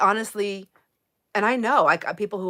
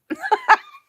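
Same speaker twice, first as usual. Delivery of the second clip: high burst of real laughter; a bright giggling shriek; squealing laugh; 6 dB louder than usual. Real, not acted. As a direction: crack up at your own joke. Joke about needing a spanking.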